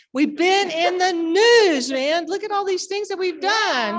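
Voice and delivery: excited. Real, not acted. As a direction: show off the press coverage with delight. Excited boasting about news coverage.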